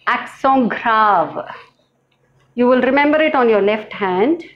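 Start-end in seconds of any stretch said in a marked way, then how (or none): 0.1-1.4 accent grave, slow and French; slow French demo of accent grave; French pronunciation. Shown as a demonstration. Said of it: "is called".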